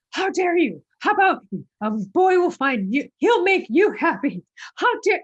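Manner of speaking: high, loud, mock-outraged scolding; indignant rant. Scolding the movie's advice to a little girl, indignant. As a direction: tell off the TV line with theatrical outrage. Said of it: "at the T V, I was like".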